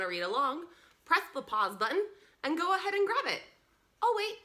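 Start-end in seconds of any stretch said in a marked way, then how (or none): none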